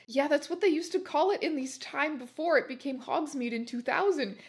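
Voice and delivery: ordinary delivery, nothing marked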